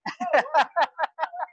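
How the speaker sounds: high amused laugh; a bright laugh at being beaten to her topic. Real, not acted.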